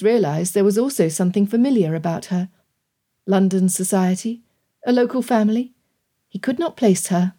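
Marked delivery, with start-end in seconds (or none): none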